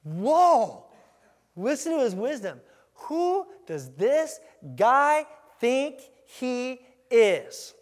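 loud, drawn-out mocking disbelief; high, indignant sarcasm; scornful attitude. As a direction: stretch each word as an offended sceptic. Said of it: "That was their attitude".

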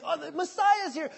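high, loud acted excitement; excited pilgrim. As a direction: exclaim it as a thrilled pilgrim. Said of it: "these excited pilgrims".

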